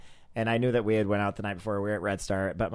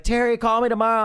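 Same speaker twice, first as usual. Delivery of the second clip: high, loud call across; 12 semitones higher, 9 dB louder than usual. Retold or imitated. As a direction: shout it after her. Friend calling after her.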